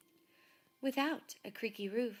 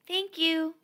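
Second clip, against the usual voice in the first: much higher, louder thanks; grateful Laszlo; 10 semitones higher, 13 dB louder than usual. A narrator's reading. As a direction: call out thanks with relief. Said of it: "said Laszlo".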